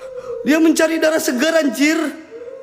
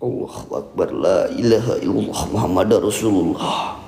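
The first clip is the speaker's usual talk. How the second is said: low, measured recitation; self-calming prayer; 14 semitones lower than usual. Real, not acted. Shaken ghost hunter praying to calm himself, low recitation. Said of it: "gua menenangkan diri".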